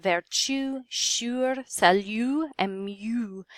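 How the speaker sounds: "vertu, sure, salut, mieux" with a y sound before the U; consonantal U. Shown as a demonstration. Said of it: "you can kinda hear that y sound".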